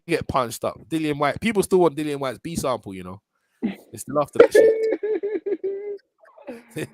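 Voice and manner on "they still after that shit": high, stretched laughing wail; laughing cry. Real, not acted. Laughing about B sample.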